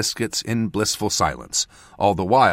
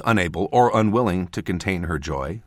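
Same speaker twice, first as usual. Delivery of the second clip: ordinary delivery, nothing marked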